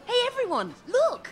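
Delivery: high excited call to the room. Acted. Calling the whole pub to look, excited. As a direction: call everyone over, thrilled. Calling crowd to see hedgehog.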